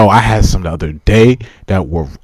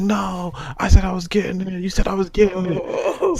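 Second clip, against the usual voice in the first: put-on higher, softer, slower whining child; mild mock crying, higher; mock bawling kid; 10 semitones higher, 8 dB quieter than usual. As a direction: imitate a sobbing little kid pleading. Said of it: "And I started bawling".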